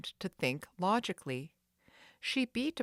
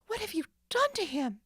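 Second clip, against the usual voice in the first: fast high anxious question; frightened demand; 10 semitones higher, faster than usual. Acted. Nancy fears for the caretaker, fast frightened question. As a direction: blurt it out in fear.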